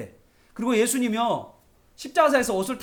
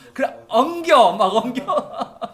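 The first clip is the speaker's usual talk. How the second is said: laughing; 5 semitones higher, 8 dB louder, much slower than usual. Retold or imitated. Children clinging, preacher laughing.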